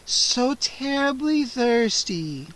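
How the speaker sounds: slow, sighing vampire hunger; hungry sigh. A narrator's reading. Scene: vampire Gwendolyn sighs her hunger to Freddy, slow. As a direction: let it sag out slowly.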